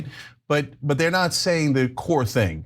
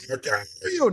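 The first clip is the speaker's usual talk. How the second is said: "How dare you?" in a much higher mock-outraged voice; mocking quote; 4 dB quieter than usual. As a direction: squeal it like an offended host. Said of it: "they were like".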